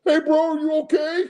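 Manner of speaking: high, squeaky mock concern; mock lineman. Streamer mocking linemen checking on quarterback, high squeaky. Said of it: "standing there like".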